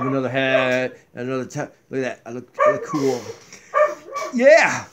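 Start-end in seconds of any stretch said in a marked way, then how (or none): none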